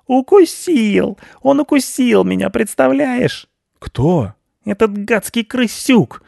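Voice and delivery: very high outraged cat voice; a bitten cat ranting to his friend. Acted. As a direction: squeal your grievance high and hurt.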